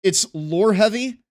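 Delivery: emphatic host voice, lightly done; show host intro. Acted. Hosts a mock show intro.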